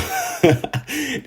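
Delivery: a short, very high giggle; high laugh. Real, not acted. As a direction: giggle in disbelief. Laughs at sad situation.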